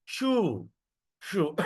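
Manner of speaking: shu with a drawn-out vowel; pronunciation demo. Shown as a demonstration. Teacher shows students how the word sounds, pronunciation demo. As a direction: stretch the U in shu. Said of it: "how are we gonna pronounce this word?".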